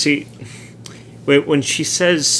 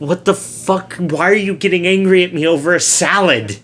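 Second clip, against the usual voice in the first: higher-pitched mock protest; indignant outburst; 5 semitones higher than usual. Confused man protesting to his angry coworker, mock indignant. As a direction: play up the baffled indignation.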